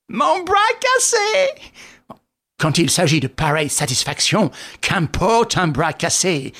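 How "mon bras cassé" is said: high-pitched squeaky companion; thin high character voice; Wilson, injured sidekick. Acted. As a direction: pipe up in a high, put-on voice.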